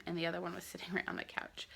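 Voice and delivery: real whisper, fast and soft; hushed whisper. Real, not acted. Whispering while baby naps.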